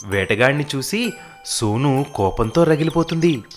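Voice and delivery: usual speaking voice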